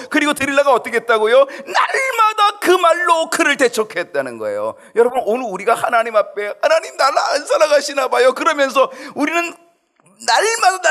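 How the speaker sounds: high, loud impassioned sermon; full-force preaching. Acted. Pastor urging the congregation to nag God daily, loud preaching. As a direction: preach it out at the top of your voice.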